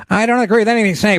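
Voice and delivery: caller quote. Retold or imitated. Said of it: "the people calling in".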